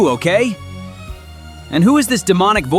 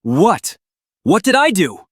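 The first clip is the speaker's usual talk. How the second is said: alarmed shout; 4 semitones higher, 7 dB louder than usual. Acted. Startled stranger protests being ordered.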